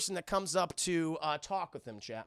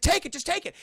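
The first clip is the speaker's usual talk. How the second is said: urgent plea; 11 semitones higher, 5 dB louder than usual. Retold or imitated. Acted urgent plea to a friend.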